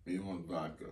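a light put-on Russian accent. Asked for his Russian accent, light mock Russian accent. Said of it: "what would your Russian accent sound like".

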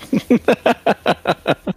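high laugh. Real, not acted. Laughs at own joke.

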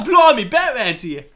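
loud, high comic British alarm; hammed-up English shout; Jim Gordon gone English. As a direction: holler it in a mock-English accent. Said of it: "where Jim Gordon's like".

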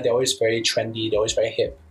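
ordinary talk, nothing marked

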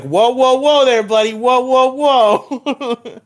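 high, loud mock alarm; loud, high-pitched acted protest; alarmed whoa-whoa. Voicing Ryuk snatching the notebook.

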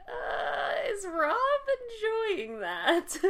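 drawn-out, hesitant mock disbelief; retold bewilderment. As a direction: puzzle over it with exaggerated doubt. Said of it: "we were like".

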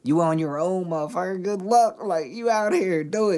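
high, mocking voice of a harsh world; mocking tough world. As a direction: lay it on like the world kicking you out. Voices the world telling graduates off.

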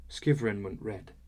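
ordinary delivery

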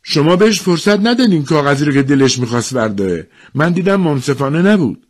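high, loud complaint; acted indignant yell; shouting protest. A narrator's reading. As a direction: shout out that you were cheated. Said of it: "داد زد".

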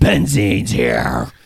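low, slow menacing villain; scary villain voice. Acted. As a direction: growl it like a supervillain. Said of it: "Why do you say it like that?".

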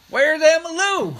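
high, loud, drawn-out holler; calling out. Retold or imitated. Aunt searching for her dog, loud high call. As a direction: shout across the yard for the dog.

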